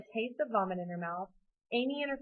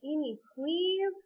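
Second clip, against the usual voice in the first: drawn-out higher-pitched plea; pleading; 7 semitones higher, slower than usual. A narrator's reading. Dad begging Amy for help, pleading. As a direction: plead slowly, stretching the please. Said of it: "her dad pleaded".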